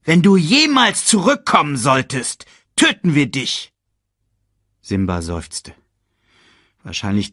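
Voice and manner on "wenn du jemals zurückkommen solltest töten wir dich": hoch und laut hinterhergerufen; drohender Hyänenruf. Acted. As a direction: wie eine Hyäne drohend nachrufen. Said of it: "hatten sie Simba noch nachgerufen". (laute Hyänendrohung aus der Ferne)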